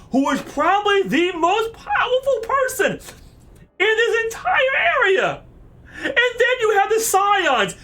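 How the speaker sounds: high, incredulous shouted tirade; outraged rant. Real, not acted. Player scolding the queen over the Syndicate, outraged. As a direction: argue it out at the top of your pitch.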